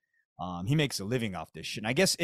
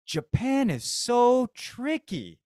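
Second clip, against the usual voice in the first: slow, higher drawn-out amazement; slow mock amazement; 8 semitones higher, 4 dB louder, much slower than usual. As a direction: draw it out, mock impressed. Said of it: "I was like".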